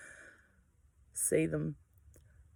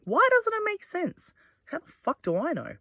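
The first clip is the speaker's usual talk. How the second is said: mock bafflement; 13 semitones higher than usual. Retelling her own confusion, high mock bafflement. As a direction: act out being baffled by nonsense. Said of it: "I'm like".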